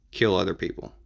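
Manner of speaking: ordinary talk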